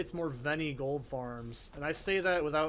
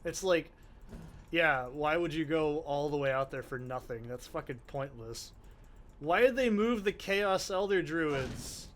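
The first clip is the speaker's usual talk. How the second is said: ordinary talk, nothing marked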